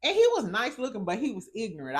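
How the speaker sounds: normal talking voice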